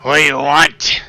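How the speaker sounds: gruff, loud, suspicious shopkeeper; gruff speaker voice. Acted. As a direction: bark it through a crackly speaker. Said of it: "you hear a gruff voice".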